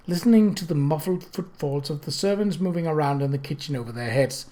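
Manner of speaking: ordinary delivery, nothing marked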